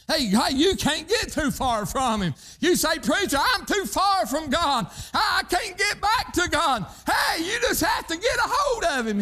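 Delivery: high, climbing preacher's cry; preaching at full force. Acted. Preacher answering a doubting listener, climbing cry. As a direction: lift it up and call it out.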